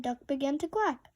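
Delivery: ordinary delivery, nothing marked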